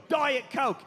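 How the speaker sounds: exaggerated star summoning a drink. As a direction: order it imperiously in a put-on British voice. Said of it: "if all of us could go".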